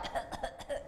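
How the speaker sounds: short soft hacking choke; mock choking. Shown as a demonstration. Speaker showing what she doesn't mean by choking, mock choking coughs. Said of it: "not like".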